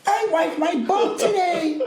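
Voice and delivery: put-on very high, slow toddler voice. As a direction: squeak like a proud toddler. Voicing a proud toddler.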